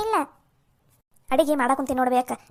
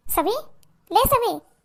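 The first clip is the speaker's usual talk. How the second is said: raised, high-pitched call for attention; 8 semitones higher, 5 dB louder, slower than usual. Acted. Woman calls her daydreaming kitchen companion, calling out. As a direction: call sharply to someone who isn't listening. Calling an inattentive co-sister-in-law.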